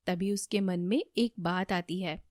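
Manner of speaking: ordinary delivery, nothing marked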